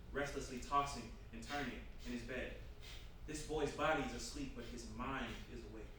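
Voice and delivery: ordinary delivery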